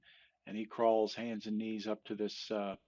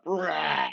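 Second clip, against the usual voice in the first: loud, raised mock roar; bear roar; 6 semitones higher, 7 dB louder than usual. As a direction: roar like an angry bear woken up. The bear wakes up.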